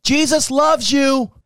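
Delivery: slow, high "Jesus loves you"; mouthed blessing. Retold or imitated. Man at the window mouthing to the driver, slow high blessing. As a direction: lift it up slowly, like a blessing. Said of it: "He's mouthing".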